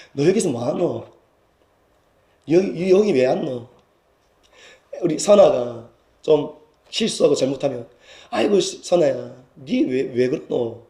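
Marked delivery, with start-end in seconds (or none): 0.1-3.7 Gyeongsang dialect. Acted. God asking Elijah kindly, in Gyeongsang dialect. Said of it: "경상도 말로". 8.3-10.8 slow, soft, low fond fussing; fond parental Gyeongsang murmur; loving, not scolding. Retold or imitated. Parent to a child who slipped up, lovingly. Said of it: "나무라는 게 아니라 사랑스러워서".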